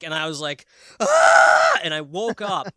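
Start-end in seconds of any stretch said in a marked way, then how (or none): 1.0-1.8 high, loud short scream of fright; mock terrified yelp; retold scream. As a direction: jolt awake screaming ah. Retold scream waking from nightmare.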